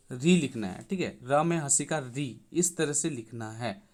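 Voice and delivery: ordinary talk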